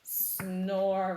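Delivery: loud, drawn-out snore word; heavy storybook snooze; sleeping mole. A narrator's reading. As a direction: stretch it out like a slumbering animal. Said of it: "replied Mole".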